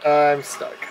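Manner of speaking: usual speaking voice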